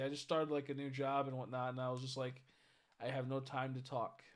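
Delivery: normal talking voice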